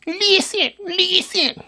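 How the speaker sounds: put-on very high childlike pleading; a small child begging someone to listen; little five-year-old. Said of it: "Like that little five-year-old".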